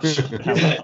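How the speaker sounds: normal talking voice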